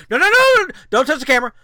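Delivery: high, loud sudden alarm; panicked no. Retold or imitated. Handler stopping George touching the camera, loud and high alarm. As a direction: stop him grabbing the camera.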